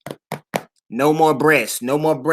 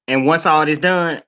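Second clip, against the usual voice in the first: normal talking voice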